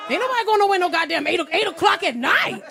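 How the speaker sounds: put-on loud, high, fast old-man rant; furious old neighbour, loud and high; old man's outburst. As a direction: bark it at her like a cranky old man. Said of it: "He said".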